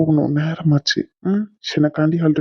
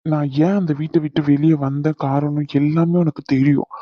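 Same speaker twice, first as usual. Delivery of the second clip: ordinary talk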